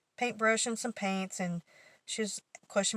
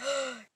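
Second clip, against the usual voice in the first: imitated gasp; 6 semitones higher than usual. Coworker's reaction to prank reveal.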